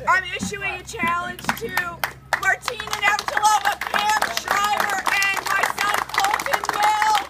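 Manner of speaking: shocked shouting from ice water; in ice cold water. Real, not acted. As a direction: yell through the cold shock. After ice water poured on her.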